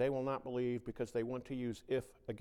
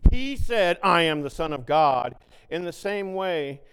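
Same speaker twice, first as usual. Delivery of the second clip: loud, higher proclaiming; preaching; 4 semitones higher, 11 dB louder than usual. Acted. Preacher reading the gospel to his flock, loud. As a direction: declaim the scripture.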